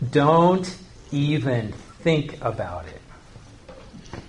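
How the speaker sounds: "Don't even think about it" at half speed; slow, weighty "Don't even think about it"; emphatic no. Shown as a demonstration. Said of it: "if you really want an emphatic no".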